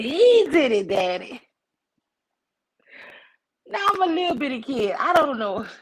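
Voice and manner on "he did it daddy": put-on high, loud kid telling on someone; accusing child. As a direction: blurt it out to daddy, acting a small child. Child pointing out culprit to dad.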